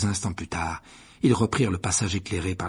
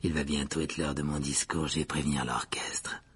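low, soft ghostly gentleman; proud host; 7 semitones lower, 6 dB quieter than usual. A narrator's reading. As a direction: confide it proudly, dropping low and quiet. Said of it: "dit Nick fièrement".

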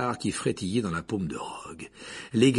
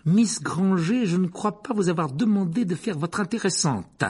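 Snape sneering; 8 semitones higher, 4 dB louder than usual. Acted. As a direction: drag out every insult to the pupil. Rogue snubs Hermione. (professeur méprisant, voix haute et lente)

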